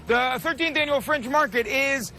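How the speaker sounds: ordinary talk, nothing marked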